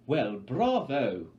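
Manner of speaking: loud, slow, drawn-out delight; a host overjoyed by drama; gushing Effie. Acted. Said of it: "gushes Effie Trinket".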